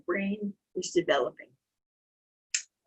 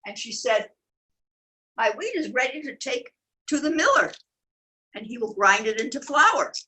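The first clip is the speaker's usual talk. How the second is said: lively storybook reading; animated picture-book narration; reading to children. A narrator's reading. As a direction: tell it to little ones.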